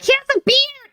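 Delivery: high-pitched retold cry of discovery; sudden high-voiced excitement; excited exclamation.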